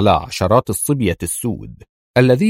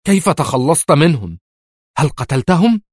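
fierce; 7 semitones higher than usual. A narrator's reading. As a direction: pounce on him with the question. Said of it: "وقالت في شراسة". (سؤال شرس بصوت أعلى)

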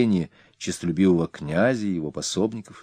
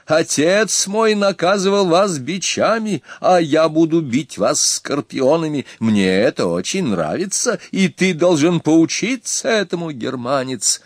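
1 taunting threat; 9 semitones higher, 4 dB louder than usual. A narrator's reading. Ernak quoting mockingly.

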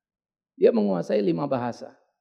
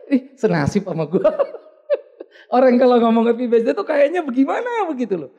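laughing; 7 semitones higher, 7 dB louder than usual. Real, not acted.

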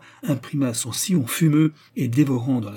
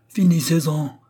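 commanding shout from the crowd, raised and loud; imperious shout; 5 semitones higher, 5 dB louder than usual. A narrator's reading. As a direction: shout it as a sharp order. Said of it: "cria une voix partie du premier rang". (cri impérieux)

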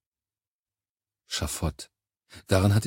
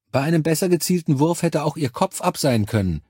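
higher-pitched taunt; needling; 7 semitones higher than usual. A narrator's reading. Charlie taunting the injured commander, needling. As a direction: needle your commander with a high-pitched jibe. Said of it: "stichelte Charlie".

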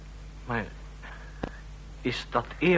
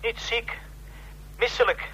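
anxious pleading; 7 semitones higher than usual. Acted. Distressed late-night call.

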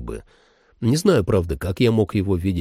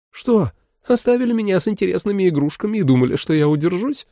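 higher, fast teasing woman; 10 semitones higher, faster than usual. Acted. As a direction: tease like a cocky woman. Holly teases.

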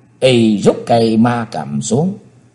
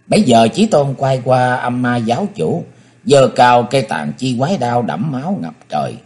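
usual speaking voice

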